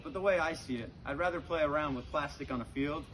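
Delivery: ordinary talk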